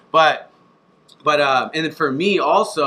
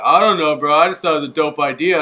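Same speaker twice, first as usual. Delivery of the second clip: louder, lazy bro shrug; clueless bro voice; 3 semitones higher, 7 dB louder than usual. Retold or imitated. Owner mocking a pitch he gets, louder bro voice. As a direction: play the clueless guy with a big idea. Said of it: "most people are like".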